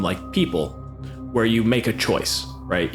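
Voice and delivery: ordinary delivery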